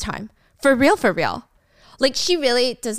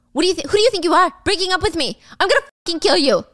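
fast, higher, louder rage; furious threat; 7 semitones higher, 3 dB louder, faster than usual. Retold or imitated. Michael threatening his girlfriend for dumping him, enraged.

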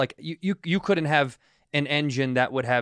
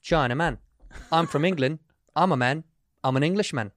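imitated English driver's accent; chatty Englishman impression, British accent; 2 semitones higher than usual. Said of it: "the accent you just did".

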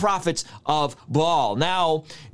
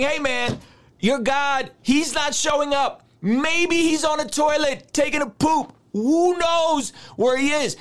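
taunting a rival crowd with a grin; trash talking; 8 semitones higher than usual. Retold or imitated. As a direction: rub it in like a heckler. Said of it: "He's trash talking".

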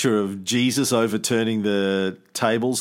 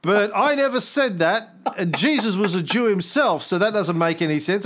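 higher mocking voice of the upset commenter; mock whiny commenter; 7 semitones higher than usual. Imitating the angry commenter's denial in a higher mocking voice. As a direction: parody his indignant denial, pitched up. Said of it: "And he goes".

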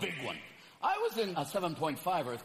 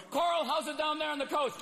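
anchor calling out; 9 semitones higher than usual. Acted. A studio anchor calling out to the reporter on the coast. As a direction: toss it to the reporter.

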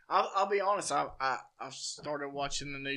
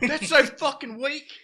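mock whiny complaint; 11 semitones higher, 11 dB louder than usual. Quoting a Basketball character.

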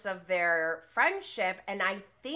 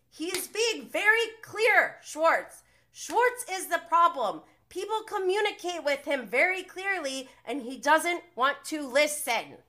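loud, high, exasperated outburst; loud frustrated defense of James; heated rant; 6 semitones higher, 9 dB louder than usual. Real, not acted. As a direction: rant hard at Schwartz in frustration.

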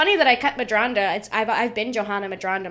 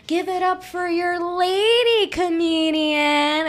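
higher, drawn-out emcee announcement; mock host introduction; 6 semitones higher, much slower than usual. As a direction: imitate a cheesy host, stretching the words. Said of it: "The second you do the".

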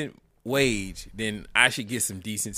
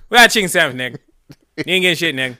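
loud, brash cashier yell; 4 semitones higher, 9 dB louder, much faster than usual. Retold or imitated. Customer imitates the KFC worker yelling at him, loud. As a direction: bark it like the annoyed cashier. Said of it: "that's how I felt".

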